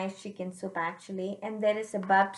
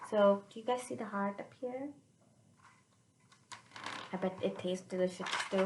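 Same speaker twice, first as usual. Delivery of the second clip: usual speaking voice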